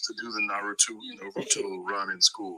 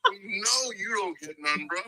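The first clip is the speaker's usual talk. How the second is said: high, slow whiny mock voice with a laugh; mocking his voice; 9 semitones higher than usual. Friend mocking the cheater's sad voice, whiny and slow. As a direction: whimper it like a pathetic crybaby. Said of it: "sound like that".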